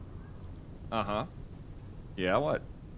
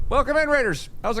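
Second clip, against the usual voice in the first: excited welcome; 13 semitones higher than usual. Real, not acted. Greeting incoming raid.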